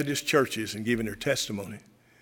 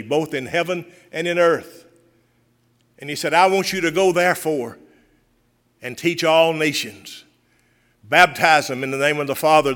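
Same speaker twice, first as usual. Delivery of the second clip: louder, lifted pulpit delivery of scripture; full-voiced preaching; 3 semitones higher, 4 dB louder than usual. Pastor proclaiming Jesus' commission to the church, fervent preaching.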